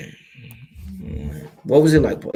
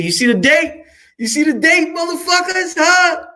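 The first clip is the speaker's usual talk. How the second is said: excited shout; 13 semitones higher, 4 dB louder than usual. Real, not acted. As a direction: pitch shoots way up, yelling at the viewers. Showing viewers the old signed date.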